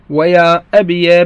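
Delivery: يا أبي said ya abiya, yā carrying a fatha; final ya with fatha. Shown as a demonstration. Teacher shows students the fatha on the yā, careful demo. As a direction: end يا أبي with an open -ya. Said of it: "بفتح الياء".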